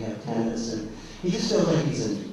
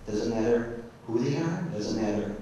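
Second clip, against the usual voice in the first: normal talking voice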